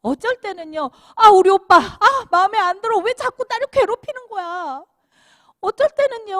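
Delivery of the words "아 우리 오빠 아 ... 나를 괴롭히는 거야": put-on high, loud whiny child complaint; grumbling kid sister. Preacher voicing a child annoyed at her brother, whiny.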